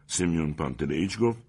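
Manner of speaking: usual speaking voice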